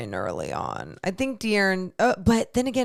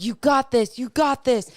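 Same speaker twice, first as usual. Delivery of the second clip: retold pep talk; 4 semitones higher, 5 dB louder than usual. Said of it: "I was like".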